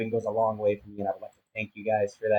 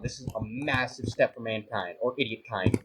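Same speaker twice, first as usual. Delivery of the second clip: ordinary talk, nothing marked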